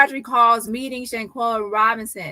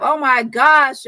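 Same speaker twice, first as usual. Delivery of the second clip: slow, loud, amazed oh my gosh; excited; 2 semitones higher, 8 dB louder, slower than usual. Real, not acted. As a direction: stretch it out in delight. Reacting to the news.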